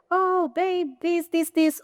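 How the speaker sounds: high, sugary "oh babe" voice; gushing girlfriend. Retold or imitated. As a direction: coo it at him like a lovestruck partner. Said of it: "be like".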